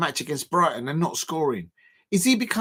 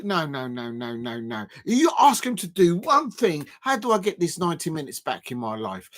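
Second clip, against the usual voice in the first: ordinary talk, nothing marked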